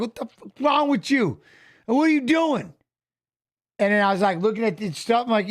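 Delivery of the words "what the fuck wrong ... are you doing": retold angry yell. Retold yelling at other driver.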